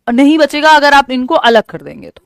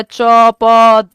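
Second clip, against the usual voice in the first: louder single syllables च, प; babbling sounds; 5 dB louder than usual. Shown as a demonstration. Teacher demonstrating babbling syllables to the class, louder. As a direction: pop out च and प like baby babble. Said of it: "babbling में single syllable को जोड़ पाता है".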